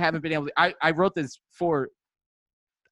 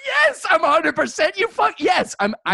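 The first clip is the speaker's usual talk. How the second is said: indignant shout; 9 semitones higher than usual. Retold or imitated. Retelling the doctor visit, outraged shout. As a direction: bark it back, offended. Angry reply to doctor's question.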